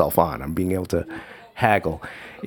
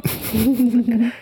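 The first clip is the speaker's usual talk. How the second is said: high laugh; 13 semitones higher than usual. Real, not acted. Laughing at dad's trick.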